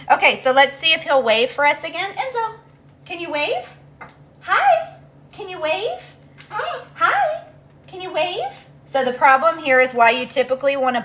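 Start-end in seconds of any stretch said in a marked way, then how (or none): 2.1-8.4 high sing-song coaxing for a bird; pet talk. Real, not acted. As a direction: coax the bird sweetly and high.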